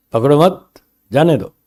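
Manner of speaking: पकड़ो मत as one rising phrase, then जाने दो apart; pause after मत. Acted. Teacher repeating the jailer's order, pause after मत. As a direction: break the line after मत. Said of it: "ये मत शब्द को इधर जोड़ेंगे".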